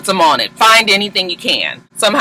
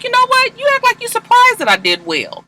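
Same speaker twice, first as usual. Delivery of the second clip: a much higher, offended squeal; indignant Cyrus. Retold or imitated. Cyrus snaps at Olivia after the debate, high and indignant. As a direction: Cyrus takes offence, voice shooting way up. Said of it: "He's like".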